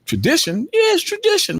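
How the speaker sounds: high, disbelieving echo; mock outrage at the word tradition; incredulous. Incredulous retort to the guys.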